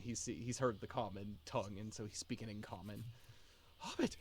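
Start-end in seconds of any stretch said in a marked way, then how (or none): 3.8-4.2 high, breathless exclamation; startled astonishment. Acted. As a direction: gasp the word in disbelief. Dazed patient startled by a hobbit.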